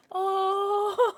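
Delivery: high "oh" breaking into sobbing laughter; tearful cooing laugh. Real, not acted. Overwhelmed unwrapping a soft plushie.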